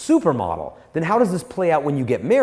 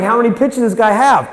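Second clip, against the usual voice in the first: louder, higher, amazed question; baffled batter; 7 semitones higher, 7 dB louder than usual. Retold or imitated. Batter baffled by the pitcher's bluff, loud and amazed. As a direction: sound stunned at the pitcher's arsenal.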